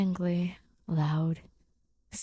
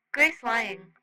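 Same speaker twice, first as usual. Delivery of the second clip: higher, matter-of-fact alien report; eager alien Rocky voice; 5 semitones higher, 3 dB louder than usual. Acted. Rocky happily telling Simon about Grace's lying tells, high alien voice. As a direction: list the evidence like an eager alien.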